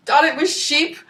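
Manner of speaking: usual speaking voice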